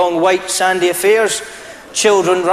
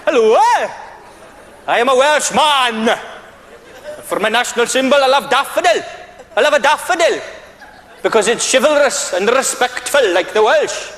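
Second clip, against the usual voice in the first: lilting sing-song Welsh voice, higher; Welsh accent; 5 semitones higher than usual. Pretend. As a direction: play a proud, polite Welshman. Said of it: "maybe the Welsh turn up".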